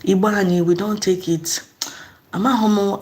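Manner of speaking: usual speaking voice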